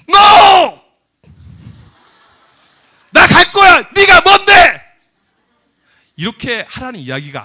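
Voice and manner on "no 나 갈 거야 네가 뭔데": full-force, high defiant yell; defiant shout. Acted. Student yelling back at the teacher over the retreat, defiant shout. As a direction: shout back at the top of your voice. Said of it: "그럴 때 소리를 질라고요".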